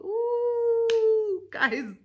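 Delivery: very high, loud, squealed ooh; high squeal of disbelief; exasperated ooh. Real, not acted. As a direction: let out a shrill exasperated ooh. Exasperated exclamation at the rule.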